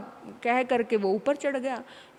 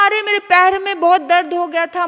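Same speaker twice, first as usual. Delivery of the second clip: loud excuse; 6 semitones higher, 10 dB louder than usual. Acted.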